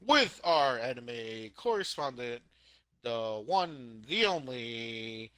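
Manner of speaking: slow, stretched show intro, starting high; big mock newscast introduction, name held very long; hammed-up announcer. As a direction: draw out every title like a ring announcer. Plays news show announcer introducing correspondent.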